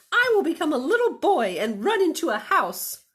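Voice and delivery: high, loud little bunny. Acted. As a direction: play the runaway bunny, bright and eager. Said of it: "said the bunny".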